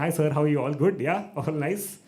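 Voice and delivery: loud mock-friendly small talk; chirpy greeting. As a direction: greet with put-on cheer, each question lifted. Acts chirpy greeting to vice principal.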